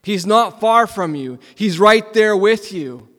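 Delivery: fervent reassurance. Real, not acted. Preacher assuring the church God is near, fervent. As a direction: insist he is right there.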